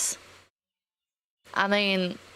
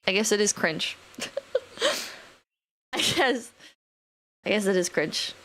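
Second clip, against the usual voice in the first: mock dejection; 2 semitones lower than usual. Streamer replays conceding to people who mocked odious, mock dejected. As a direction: concede it like a sheepishly wounded kid, half-crying. Past self conceding to critics.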